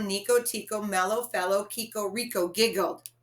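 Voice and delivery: ordinary delivery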